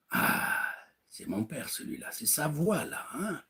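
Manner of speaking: awed hushed murmur; hushed awe. Retold or imitated. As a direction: murmur as if awestruck. Daughter recognizes father's voice, hushed.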